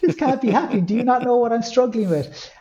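high, disbelieving cry; incredulous disbelief. Retold or imitated. Arriving at Pieta House reception, high incredulous disbelief. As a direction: sound stunned it's really happening.

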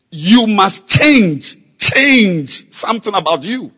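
slow, loud, hammering sermon delivery; preaching at full force. Acted. Preacher urging the church to change, full force. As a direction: drive every word home to the congregation.

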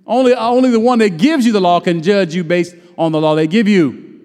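preaching. Acted. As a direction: preach it out to the church.

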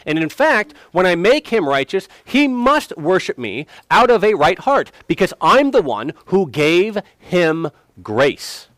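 high, loud, emphatic acted proclamation; God's declaration. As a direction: declare it forcefully, slowing down at the end. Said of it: "And God says".

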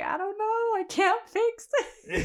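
very high, hammed-up whine, slowing at the end; mock helpless wail. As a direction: pretend to cry helplessly, half laughing. Imagines being stuck with new accent.

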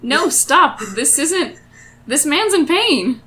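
urgent protest. Acted. Adventurer stops friend applauding a suffering gnome, urgent protest. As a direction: cut in to stop him, alarmed.

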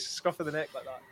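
normal talking voice

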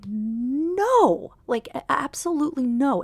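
loud, high retold "no", drawn out; emphatic refusal. Niece refusing her uncle's pressure, emphatic refusal. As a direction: act out an appalled, forceful refusal.

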